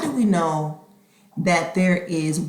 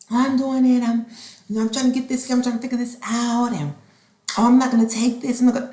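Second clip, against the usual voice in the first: slightly higher, lightly voiced harried child; protesting child; 3 semitones higher, 4 dB louder, faster than usual. Child answering mother about packing, lightly mocked protest. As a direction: lightly imitate a kid making excuses.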